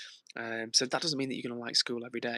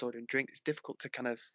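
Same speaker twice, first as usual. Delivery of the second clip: usual speaking voice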